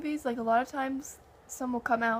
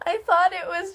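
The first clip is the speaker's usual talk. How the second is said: laughing while talking; 7 semitones higher, 8 dB louder than usual. Real, not acted. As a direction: talk through laughter. Laughing while telling clown story.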